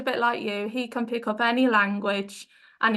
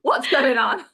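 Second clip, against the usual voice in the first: louder, playful baffled cry; mock bewilderment; 2 semitones higher, 6 dB louder than usual. Interviewer voicing her brain swamped by languages, mock bewildered. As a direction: act out a brain totally lost. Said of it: "my brain would just be like".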